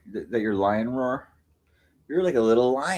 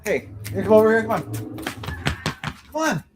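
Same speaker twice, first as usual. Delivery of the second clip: high calling to cat; 9 semitones higher than usual. Real, not acted.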